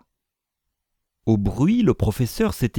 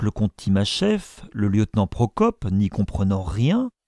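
ordinary delivery, nothing marked

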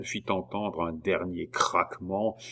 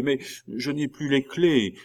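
higher-pitched, mildly anxious jailer; trembling jailer; 6 semitones higher than usual. A narrator's reading. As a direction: plead helplessness, slightly nervous. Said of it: "Un geôlier tremblant". (geôlier un peu craintif, voix haute)

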